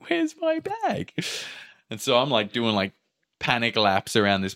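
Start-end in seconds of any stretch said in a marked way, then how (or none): 0.1-1.1 high, slow rising worry. Retold or imitated. His bag still missing.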